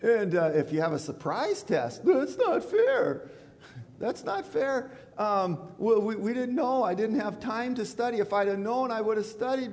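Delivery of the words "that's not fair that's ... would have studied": high, whining student protest; whiny students. Retold or imitated. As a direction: whine like a kid caught out by a test. Students whining about surprise test.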